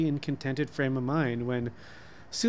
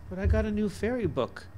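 put-on little girl voice; 7 semitones higher than usual. A narrator's reading. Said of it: "she said".

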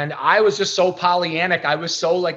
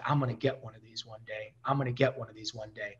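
quiet inner voice to herself; murmured private promise; inward vow; 5 semitones lower, 10 dB quieter, faster than usual. Retold or imitated. Whispering a promise to herself in the car. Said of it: "I just inwardly, I was like".

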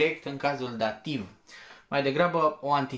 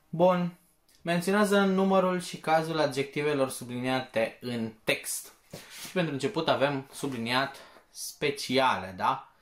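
ordinary delivery

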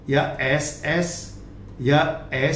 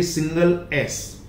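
ordinary talk, nothing marked

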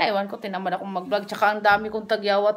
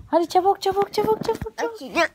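excited urging; 11 semitones higher, 4 dB louder than usual. Acted. Acting to calm baby.

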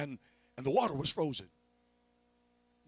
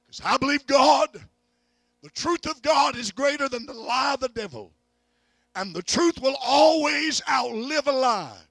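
high, loud preacher's cry, slow; preaching at full force; 10 semitones higher, 12 dB louder than usual. Real, not acted. Preacher declaring God's truth to the church, a high loud cry. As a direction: shout the faith over the lie.

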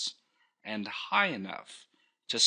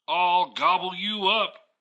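loud, higher growling troll threat; mean troll voice; 9 semitones higher, 8 dB louder than usual. Acted. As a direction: bellow it like a big mean troll. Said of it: "cried the troll".

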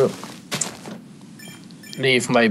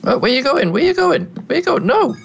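high-pitched coaxing call; calling after an animal. Real, not acted. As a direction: call after a runaway pet. Calling after runaway chicken.

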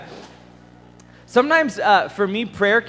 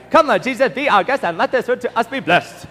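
rattled off through laughter, higher; laughing rote prayer; 5 semitones higher, 4 dB louder than usual. Retold or imitated. Preacher mocking the meaningless grace, laughing. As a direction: chuckle while gabbling the grace. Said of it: "it just becomes like sounds".

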